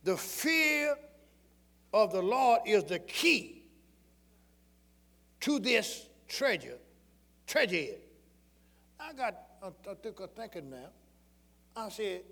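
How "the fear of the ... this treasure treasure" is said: slow stressed preaching; emphatic repetition. Real, not acted. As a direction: drive the verse home slowly. Repeating verse for emphasis.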